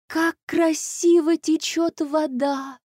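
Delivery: high, slow, drawn-out wonder; admiring. A narrator's reading. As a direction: marvel at it, stretching the words. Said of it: "восхитилась Василиса". (восхищённо)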